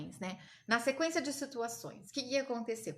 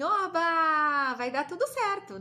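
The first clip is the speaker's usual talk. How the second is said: loud, high, drawn-out hooray; excited cheer; 5 semitones higher, 7 dB louder, slower than usual. Acted. Imagines cheering that all works out.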